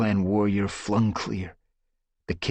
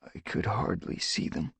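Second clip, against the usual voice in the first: soft, breathless whisper; weak whisper; 7 dB quieter than usual. Acted. Firestar answering Leafpaw weakly, whispering. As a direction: barely voice the words.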